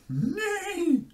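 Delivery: high, loud, falling whinny; full-might neigh. A narrator's reading. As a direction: neigh as hard as you can. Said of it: "with all her might".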